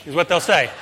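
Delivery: ordinary talk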